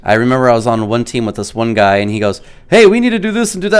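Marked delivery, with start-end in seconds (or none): 2.7-3.8 quick, high-pitched eager teammate; hurried retold urging; urgent teammate. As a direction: imitate a teammate rushing out a plan. Teammate urging him with a plan.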